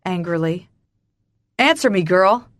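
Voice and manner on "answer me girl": loud, forceful order; harsh, commanding insistence; angry demand. A narrator's reading. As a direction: press for an answer, voice raised. Father demands an answer.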